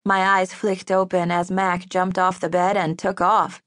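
plain reading voice

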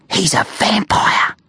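slow, hushed revelation; spooky whisper. A narrator's reading. Kids spreading word of the new kid, whispered. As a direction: whisper it slowly as a dreadful secret. Scary rumour passed round school.

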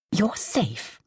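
gentle reassuring whisper. Acted. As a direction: soothe her like a frightened child. Hostess comforts the shaken medium.

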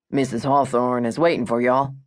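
put-on light Texan cowboy accent; a hint of a polite Texan cowboy; Texan drawl. Ranch gate cowboy greets the visitors, light Texan accent. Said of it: "I love the Texan accent".

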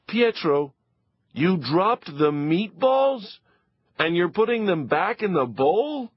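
can't believe what she sees; scolding mother. Acted. Mother scolding Pietro over meatballs, high and incredulous.

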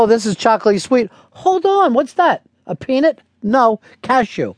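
high-pitched mock wonder at a snack; acted delighted surprise. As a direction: play a taster discovering each flavour, pitched high. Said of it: "You're like".

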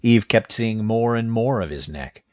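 usual speaking voice

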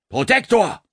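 high, loud call from across. Acted. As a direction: call "Protektor!" across the noisy room. Officer calls from far side.

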